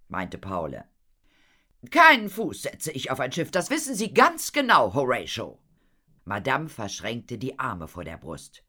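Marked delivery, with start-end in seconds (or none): 1.9-5.6 loud, high, then dropping on the name; indignant refusal. Acted. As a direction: refuse point-blank, loudly. Madame angrily refuses the ship. (empört und laut)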